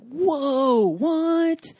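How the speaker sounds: high, loud shocked whoa; surprised. Retold or imitated. Pastor reacting to their Uganda news, shocked. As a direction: jolt of disbelief. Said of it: "and I'm just like".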